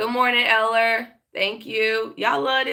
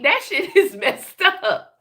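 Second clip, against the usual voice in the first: laughing through the words, slow; 7 semitones higher, slower than usual. Real, not acted. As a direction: chuckle it out. Laughing after grandma story.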